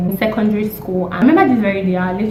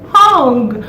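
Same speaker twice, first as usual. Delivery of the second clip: emphatic outburst; 5 semitones higher, 7 dB louder than usual. Real, not acted. Angry emphatic repeat.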